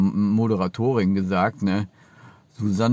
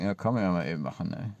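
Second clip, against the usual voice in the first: ordinary talk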